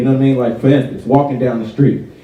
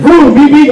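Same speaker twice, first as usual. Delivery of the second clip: higher, louder beep beep; mock car horn beeps; 11 semitones higher, 5 dB louder than usual. Comedian mimicking honking drivers, mock horn beeps. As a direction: toot it like a horn.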